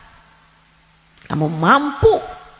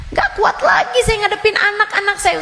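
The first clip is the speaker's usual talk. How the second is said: pitched-up tired mother, rushed; high whiny complaint; 11 semitones higher, faster than usual. Acted. A mother to the ustazah, high and whining. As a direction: whine it fast and high.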